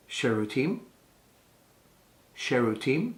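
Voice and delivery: Sherutim lifted at the end like a question; asking tone. Shown as a demonstration. Teacher models asking someone for the toilet, Sherutim with questioning rise. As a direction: ask Sherutim with a clear final rise. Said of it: "look to someone and say".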